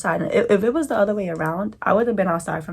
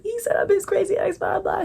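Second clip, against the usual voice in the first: high tearful complaint, words pitched way up; crying; 14 semitones higher than usual. Retold or imitated. Complaining to her best friend, high and tearful. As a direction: whine it out through tears. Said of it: "crying, like".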